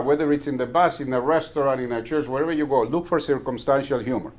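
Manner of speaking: usual speaking voice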